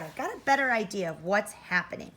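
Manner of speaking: usual speaking voice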